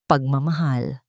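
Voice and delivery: ordinary delivery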